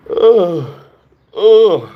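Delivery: loud, high yawn. Real, not acted. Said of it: "I'm yawning".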